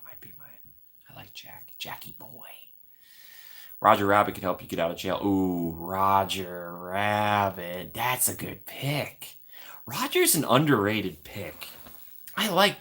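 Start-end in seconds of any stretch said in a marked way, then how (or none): 0.1-2.5 soft whisper; whispered fondness; whispered aside. Real, not acted. 5.2-9.4 savoring drawl. Real, not acted. Savouring the choice slowly.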